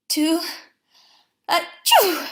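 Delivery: building to a loud achoo; sneeze. A narrator's reading. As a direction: wind up then sneeze hard. Pooh sneezes.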